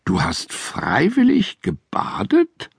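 can't believe it; incredulous. A narrator's reading. Judy amazed that the bear bathed, high incredulous question.

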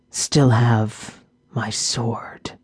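slow, whispered orc rasp; raspy whisper. A narrator's reading. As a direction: breathe it out weakly. Dying orc speaks.

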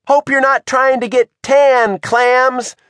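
high, loud action-hero quip; defiant taunt. A narrator's reading. Margot aims sunscreen at the clams, taunting. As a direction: taunt them as you take aim.

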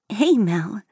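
lightly cheerful phone greeting with a lilt; a touch of gaiety in the hello; gaily. A narrator's reading. Sister answering the phone from her honeymoon, gaily. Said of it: "her sister's voice called gaily".

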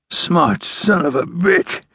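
slow, high, strained curse; resentful dying man's drawn-out grumble; pained growl. A narrator's reading. Dying outlaw cursing the ranger, growling through pain. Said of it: "growled under his breath".

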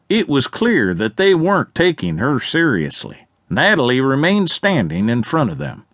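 usual speaking voice

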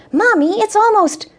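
put-on high, eager small boy; little boy voice. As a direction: chirp it like a young child. Child Tyler voiced by narrator.

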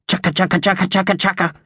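high, harsh magpie rattle; harsh battle cry. A narrator's reading. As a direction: chatter out a magpie war cry. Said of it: "It was harsher than Thug's, more of a battle cry".

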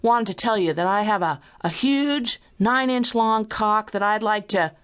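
emboldened obscene caller; gaining confidence. A narrator's reading. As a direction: push on boldly, slowing to boast. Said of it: "Picking up confidence from my silence".

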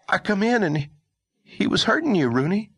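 put-on high, panicky boy's voice; a scared boy blurting it out; panicked boy.

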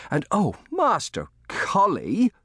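high, drawn-out cry of dismay; horrified. A narrator's reading. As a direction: gasp the name as dread sinks in. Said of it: "stifling a gasp of horror".

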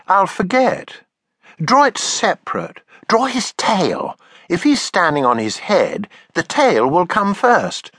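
put-on high eager little girl; child voice. Taffy copying her father's drawing: high eager child voice.

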